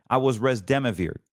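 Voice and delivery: ordinary talk, nothing marked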